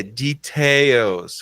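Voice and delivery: "details" slow, stressed on TAILS; stress demonstration. Shown as a demonstration. Teacher modelling English stress, "details" as dee-TAILS. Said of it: "a sílaba mais forte".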